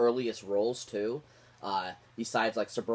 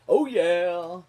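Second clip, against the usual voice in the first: loud, high "oh yeah" cheer; whooping fan cheer, pitched up; hyped cheer; 7 semitones higher, 8 dB louder than usual. Real, not acted. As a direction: cheer it out like a hype man. Hyped cheer after naming actor.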